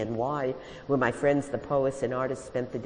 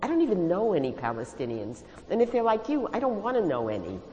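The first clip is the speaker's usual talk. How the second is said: raised, indignant retort; yelled retort; 6 semitones higher than usual. A narrator's reading. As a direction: throw it back at them. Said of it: "I yelled".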